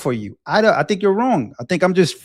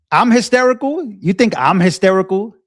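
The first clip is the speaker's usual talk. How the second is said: high-pitched, rising incredulous question; 5 semitones higher than usual. Real, not acted. Streamer answering a viewer who called him hysterical, incredulous. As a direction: throw the accusation back in disbelief.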